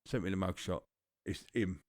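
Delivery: ordinary delivery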